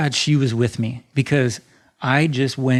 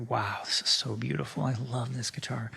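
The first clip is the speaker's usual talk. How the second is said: soft, hushed admiration; softly amazed at the finished guitar; awed hush; 11 dB quieter than usual. Retold or imitated. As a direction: murmur it in quiet awe. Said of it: "standing there looking at it like".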